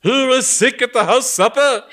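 loud, high booming taunt; thundering. Acted. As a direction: boom it like thunder. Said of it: "rang out in a tone of thunder".

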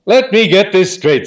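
aunt with a carrying voice, demanding; Aunt Dahlia, carrying voice. A narrator's reading. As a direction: boom it across the room like calling cattle. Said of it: "has what you might call a carrying voice".

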